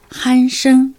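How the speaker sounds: 鼾声 as careful Mandarin hānshēng, both syllables level; model Mandarin pronunciation. Shown as a demonstration. Teacher answers what snoring is in Chinese, careful Mandarin 鼾声. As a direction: say 鼾声 evenly in Mandarin, hān-shēng. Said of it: "中国語でそのいびきというのは何と言うんでしょうかね".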